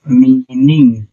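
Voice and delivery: "Meaning" slowly, drawn out in two syllables; slow dictation. Shown as a demonstration. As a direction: stretch "meaning" out for writing. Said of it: "क्या लिखेंगे इसको?". ("Meaning" खींचकर, धीरे-धीरे लिखवाते हुए)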